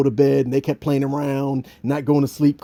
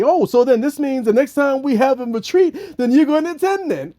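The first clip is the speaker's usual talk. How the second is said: high, teasing voice; mocking imagined critic; 9 semitones higher than usual. Imagined critic teasing about the retreat, high mock voice. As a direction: mimic a smug critic. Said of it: "people will say".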